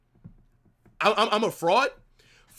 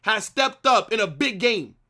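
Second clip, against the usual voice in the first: quoting himself louder; 6 semitones higher, 4 dB louder than usual. Retold or imitated. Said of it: "I literally said".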